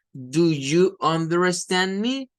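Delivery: teacher-slow, flat stress; slow classroom English. Shown as a demonstration. Teacher mimicking slow classroom English. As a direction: Do you understand me every word full and slow. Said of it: "un sonido bastante plano".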